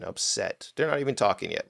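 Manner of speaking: normal talking voice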